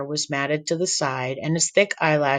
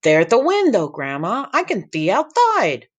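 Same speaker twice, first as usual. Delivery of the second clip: put-on high, loud excited boy; excited kid voice; 6 semitones higher, 4 dB louder than usual. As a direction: squeal like a thrilled kid. Sammy excited at window.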